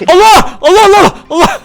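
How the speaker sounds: high, loud panicked yelps; startled cry. Real, not acted. Gamer cornered by a wolf, panicked yelps. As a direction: jump in fright.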